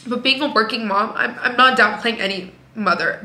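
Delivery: ordinary delivery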